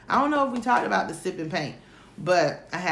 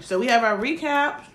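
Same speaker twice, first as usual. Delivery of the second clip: usual speaking voice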